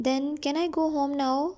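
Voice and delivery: slow, careful reading of the English text; slow story reading. A narrator's reading. Teacher reading the English line slowly to her students. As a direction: read each word out slowly for the class. Reads Tom's question slowly.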